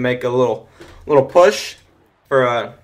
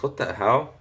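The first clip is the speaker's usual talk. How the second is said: ordinary talk, nothing marked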